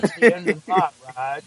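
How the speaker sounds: lightly voiced cowboy delivering his exit line; Sam Elliott. Podcaster quoting Sam Elliott's exit line, lightly imitated. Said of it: "Sam Elliott says, like".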